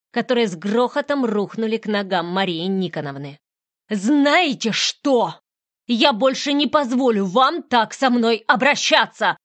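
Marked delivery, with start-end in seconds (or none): 3.9-9.4 higher, much louder trembling shout; shouting through tears. Acted. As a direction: finally explode at her. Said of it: "закричала я". (кричать сквозь слёзы)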